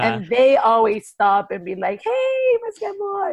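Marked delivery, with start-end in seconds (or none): 2.0-3.3 mock yell.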